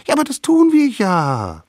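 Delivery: high, loud little voice; eager littlest savage. A narrator's reading. Littlest savage answers friends' doubts, eagerly insisting.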